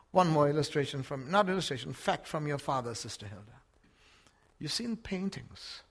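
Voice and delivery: ordinary talk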